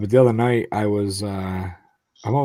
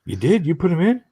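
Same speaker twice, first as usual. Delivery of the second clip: higher astonished questions; disbelieving, pitched up; mock surprise; surprised coworker; 9 semitones higher, 4 dB louder than usual. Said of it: "And he goes".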